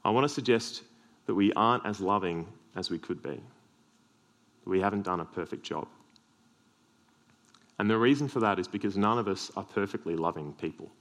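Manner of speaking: ordinary talk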